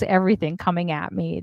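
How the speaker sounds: ordinary delivery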